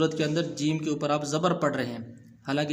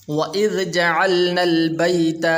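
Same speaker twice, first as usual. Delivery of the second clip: Quran recitation; 3 semitones higher, 7 dB louder than usual. Shown as a demonstration. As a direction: recite it in full tajweed. (قرآن کی تلاوت)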